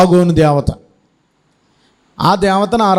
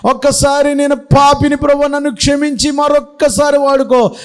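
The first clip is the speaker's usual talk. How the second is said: higher, imploring plea for one more chance; pleading prayer; 7 semitones higher than usual. Acted. Samson prays for one last chance, high imploring plea. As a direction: plead like a sinner begging forgiveness. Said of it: "ఇప్పుడు ప్రార్థన చేస్తున్నాడు".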